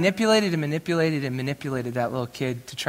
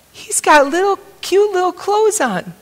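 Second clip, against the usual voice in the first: high, loud mock gushing; delighted gushing; 12 semitones higher, 8 dB louder than usual. She describes the baby she sees, delighted gushing. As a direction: imitate her squeal of delight at the baby.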